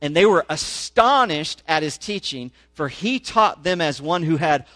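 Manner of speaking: rising, emphatic public reading of the passage; scripture reading. Acted. Pastor reading Mark to his congregation, animated scripture reading. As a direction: read the gospel out to the congregation with growing force.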